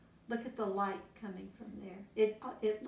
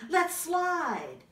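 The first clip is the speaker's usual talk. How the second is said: higher, louder cub voice; high eager cub; 9 semitones higher, 7 dB louder than usual. Acted. Biggest bear proposing a game, high eager voice. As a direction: chirp it eagerly.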